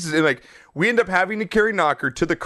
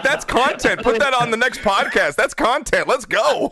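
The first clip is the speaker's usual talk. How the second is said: higher, hyped-up quote; retold enthusiasm; 6 semitones higher than usual. Storyteller quotes radio host loving the chaos, excited. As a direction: imitate a delighted host pitching content.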